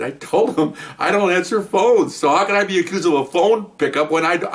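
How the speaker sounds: high, emphatic self-defense; indignant protest. Retold or imitated. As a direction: plead the obvious, exasperated. Retold indignant protest to lieutenant.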